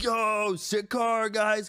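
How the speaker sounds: high, hyped dude voice; mock bro compliment. Passing kid praises the parked car, mock hyped.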